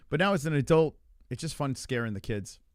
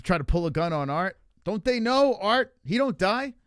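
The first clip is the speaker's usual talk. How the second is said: hyped boast; 6 semitones higher than usual. Real, not acted. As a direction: hype up the legend of Art. Boasting as Art, rising.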